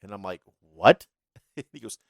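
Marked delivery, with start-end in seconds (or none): 0.7-1.1 a loud, startled "What?"; baffled what. Retold or imitated. Manager shouting back at the wrestler in confusion. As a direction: blurt it like you can't believe what you heard.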